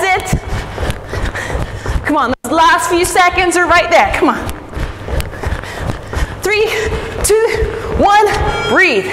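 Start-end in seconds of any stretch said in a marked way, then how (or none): none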